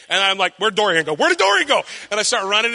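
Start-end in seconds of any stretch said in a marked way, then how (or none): 1.2-1.8 sudden high-pitched alarmed question; mock panic. Father realising his toddler has vanished, mock panic. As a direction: leap way up in pitch, playacting alarm.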